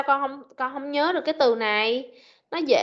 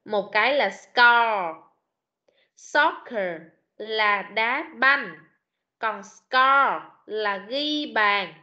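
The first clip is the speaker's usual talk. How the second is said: very slow, lower explanation; slow contrast; 3 semitones lower, much slower than usual. Shown as a demonstration. Teacher contrasting two words for the pupil, slow and deliberate. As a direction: stretch each word to show the difference. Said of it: "Thấy nó khác nhau không".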